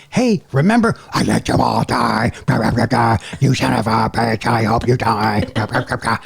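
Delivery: low growling death metal monster; scary monster voice. Retold or imitated. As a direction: snarl it like a heavy metal growler. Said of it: "Doing that voice really, really did me in here".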